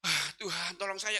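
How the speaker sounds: soft, strained gasped prayer; plead, then strain with effort; short of breath. Retold or imitated. Man reversing the car asks God's help, gasping. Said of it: "nafas saya yang tinggal satu dua".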